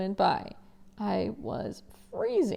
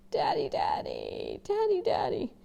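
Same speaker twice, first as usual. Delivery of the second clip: pleading; 11 semitones higher than usual. Acted. Boy calling for his father.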